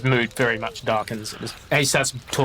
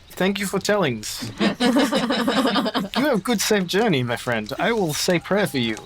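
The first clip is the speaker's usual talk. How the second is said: higher-pitched foreign traveller's farewell; grateful Baptiste; 7 semitones higher than usual. Acted. Baptiste thanks his new friend and departs, grateful and lifted. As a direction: thank them in a lifted, simple character voice.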